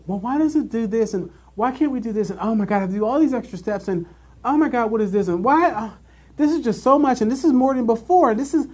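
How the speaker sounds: high nasal-free whine, pitched well up; whiny complaining coworker. Retold or imitated. Imitating a whining coworker in training, high and complaining. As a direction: mimic a coworker whining all morning. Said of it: "this is all that they did".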